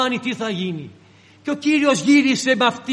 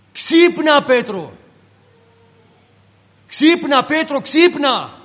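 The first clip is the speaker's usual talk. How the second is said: higher, louder, insistent calls to a sleeper; urgent wake-up call; 4 semitones higher, 5 dB louder, slower than usual. Acted. Angel calling sleeping Peter in his cell, loud and urgent. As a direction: shake him awake with your voice.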